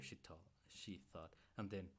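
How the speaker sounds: plain reading voice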